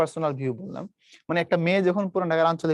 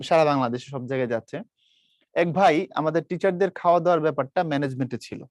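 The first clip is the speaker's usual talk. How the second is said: ordinary delivery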